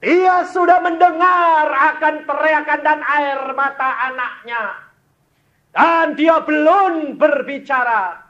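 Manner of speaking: high, loud shout of a preacher; fervent preaching shout. Acted. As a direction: cry it out to the whole church.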